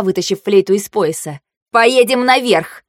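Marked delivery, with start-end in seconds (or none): 1.7-2.8 slow, higher-pitched bark; barked order. A narrator's reading. Heroine ordering the crew to go up, barking. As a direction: bark it out deliberately. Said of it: "рявкнула я".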